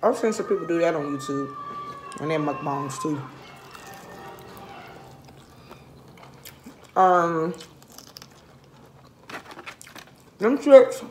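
chewing between words; talking while eating wing; mouth full of chicken. Real, not acted.

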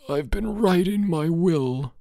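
put-on high, slow, croaking sick-boy voice. A narrator's reading. Dying boy telling his roommate about his will, croaking. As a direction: croak it like your throat is raw. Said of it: "he croaked".

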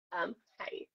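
a quick unvoiced whispered hi. Real, not acted. Greeting a newcomer in the chat, whispered. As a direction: breathe the hello without voice.

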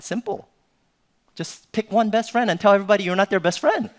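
loud, high-pitched exasperated advice; emphatic dad. Retold or imitated. Dad solving daughter's too-many-friends problem, loud and emphatic. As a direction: act out laying down the obvious fix, loudly. Dad's blunt fix, acted out.

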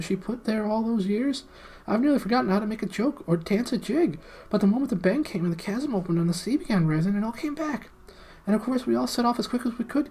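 high, eager little gnome pleading. Acted. Gnome Golg explaining to the prince and friends, high and eager. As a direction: squeak it out like a small underground gnome. Gnome Golg's long speech.

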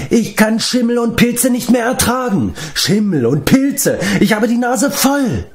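very high-pitched, wailing outburst; desperate cry. A narrator's reading. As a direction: wail in despair at the top of the range. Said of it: "schrie er verzweifelt". (verzweifeltes Schreien)

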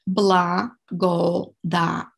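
Благодаря very slowly, split Бла-го-да-ря; syllable by syllable. Shown as a demonstration. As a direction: sound out each syllable of Благодаря for learners. Teacher breaks the word down.

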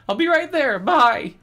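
[name]'s voice, pitched well up and loud; high-pitched frightened boy narrating; high boy's voice. Acted. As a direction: read it as a scared kid, up high. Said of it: "he said".

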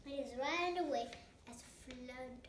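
ordinary delivery, nothing marked

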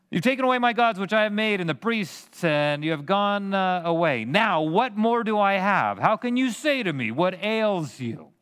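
whiny Micah. Retold or imitated. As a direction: whine it like a wronged man. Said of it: "done it in more of a sniveling tone".

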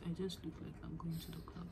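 fast breathless whisper under her breath. Real, not acted. To herself about her club-ready look, whispered.

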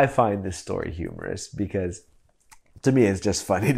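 usual speaking voice

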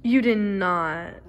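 loud slow disbelief. Real, not acted. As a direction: exclaim in disbelief. Disbelief at friend.

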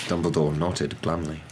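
ordinary delivery

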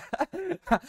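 sudden high-pitched laugh; burst of laughter. Real, not acted. A vlogger laughing at his fiancée's team, high laugh. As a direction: laugh out at the joke.

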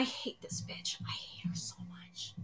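unvoiced, low whisper of disgust; whispered hatred. Real, not acted. Reader whispering his hatred of the manga character. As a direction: hiss it under your breath.

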